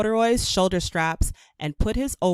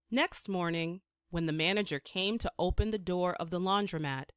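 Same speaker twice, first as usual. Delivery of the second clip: ordinary delivery, nothing marked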